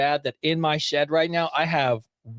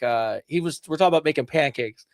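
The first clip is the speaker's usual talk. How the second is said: ordinary talk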